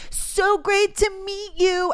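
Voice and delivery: high, slow, gushing mock politeness; fake-nice mimicry. Mocking a rude influencer's goodbye, high fake-sweet voice. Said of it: "nothing I hate more than a fake bitch".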